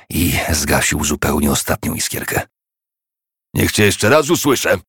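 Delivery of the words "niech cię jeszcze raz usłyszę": loud angry threat; angry shout. A narrator's reading. Scrooge threatening his clerk with an angry shout. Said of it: "zawołał z gniewem Scrooge".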